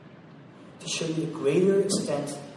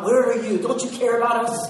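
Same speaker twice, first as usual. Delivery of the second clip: louder, raised anguished cry; anguished outcry; 6 semitones higher, 8 dB louder than usual. Acted. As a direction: cry out at an absent God. Voicing a complaint to God.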